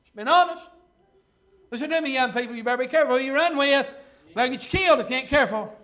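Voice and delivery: loud pulpit exclamation; full-force preaching. Acted. Preacher warning young people about their company, loud preaching. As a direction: press it home loudly to the congregation.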